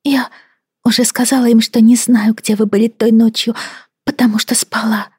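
high, timid, fading to whisper; frightened wife, barely speaking; timid young woman voice. Acted. Timid wife answers Brody.

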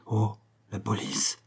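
hushed, fearful echo; shuddering fear. Acted. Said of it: "fit Torgnole en frissonnant". (effroi frissonnant, à voix basse)

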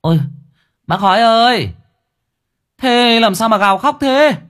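very high, loud holler from afar; high-pitched startled call. A narrator's reading. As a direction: shout over to a neighbour in alarm. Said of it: "thấy thế thì gọi".

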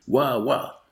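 spoken imitation of a dog's bark; worded bark, lightly put on. A narrator's reading. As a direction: woof like a small eager dog. Narrator voices the dog barking.

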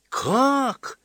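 put-on very high, wide-eyed child; astonished. A narrator's reading. Girl cannot believe they have not heard, astonished. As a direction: gasp the question up high. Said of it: "округлила глаза Ася".

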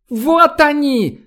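very high, drawn-out alarm call; piercing cry. A narrator's reading. Spotter pointing out the swarm, piercing drawn-out cry. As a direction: cry out shrilly, stretching it. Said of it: "пронзительно закричала Мидари".

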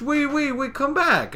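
high, pleading cartoon call; Pinkie Pie calling out. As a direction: call after friends leaving you behind. Pinkie calls after departing friends.

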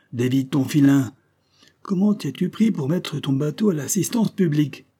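high, loud sardonic questioning; interrogating captain. Acted. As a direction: press him for his tale. Captain demands the man's story.

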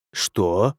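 plain reading voice

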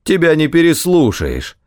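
impatient dismissal, jumping high after the opening; sharp reply. A narrator's reading. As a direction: narrator voicing a curt official. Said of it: "резко ответил ему воевода".

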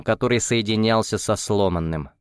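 usual speaking voice

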